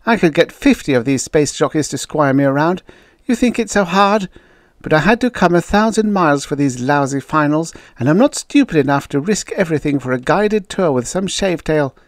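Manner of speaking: acted exasperated outburst; angry outburst. A narrator's reading. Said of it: "the redhead exploded".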